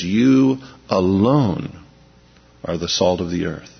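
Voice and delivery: You alone said very slowly, You stressed, long pause before are the salt of the earth; emphatic. Shown as a demonstration. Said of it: "emphatically says".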